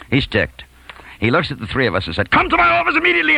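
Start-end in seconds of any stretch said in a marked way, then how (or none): 2.3-3.4 high, fast, furious yell; enraged order. Retold or imitated. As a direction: explode into a rapid high-pitched command. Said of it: "in a rage".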